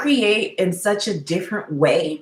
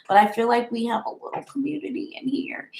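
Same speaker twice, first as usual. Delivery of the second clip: fast, softer whispered confession; 7 dB quieter, faster than usual. Real, not acted. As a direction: whisper it quickly, just between us. Intimate aside to live viewers.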